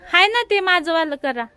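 loud friendly call to a relative. Real, not acted. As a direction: call loudly to someone outside. Calling to family member outside.

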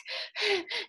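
acted wheezing breath; wheeze. A narrator's reading. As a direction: suck in air like a winded runner. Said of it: "The weaver wheezed".

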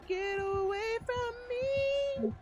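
stretched high-pitched yell; high drawn-out yell. Real, not acted. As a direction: drag it out high at the killer. Yelling at killer in game.

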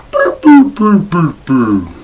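high falling video-game power-down burps; Mario power-down sound. Retold or imitated. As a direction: mimic the Mario death jingle, dropping in pitch. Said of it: "they do that power down sound".

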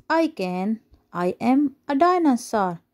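ordinary delivery, nothing marked

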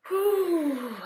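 loud, high exhale of relief; relieved whew. Real, not acted. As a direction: blow out a big whew after reading. Reader finishes a long chapter.

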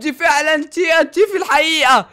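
loud, high, thrilled shouting; real hype; excited shout. Real, not acted. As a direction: yell it out, bursting with excitement. Thrilled TNT reveal.

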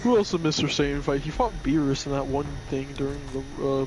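ordinary delivery

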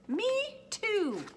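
high, squeaky little joey voice; young kangaroo. A narrator's reading. Young kangaroo echoing mother from her pouch, high piping voice.

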